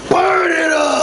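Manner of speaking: loud, high gleeful shout; short, loud shout; battle shout. Real, not acted. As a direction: yell it like a triumphant attack. Attacking with fire.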